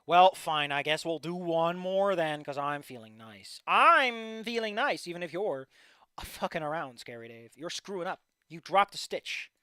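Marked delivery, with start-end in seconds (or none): 2.4-3.6 soft, low and slow; low mumble. Pretend. First take low and soft. 3.7-5.1 very slow, louder and higher; drawn out. Pretend. Repeated slowly and louder.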